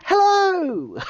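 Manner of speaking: a big bright high-pitched hello; excited hello. Real, not acted. Streamer greets the audience once the audio works, excited high hello. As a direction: burst in with a cheery greeting.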